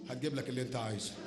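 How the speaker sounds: normal talking voice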